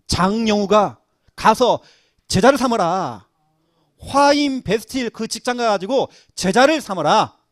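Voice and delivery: loud, slow preached command; preaching at full force. Acted. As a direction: proclaim the mission to the congregation. Preaching the great commission loudly. (설교조로 힘차게 외치는 명령)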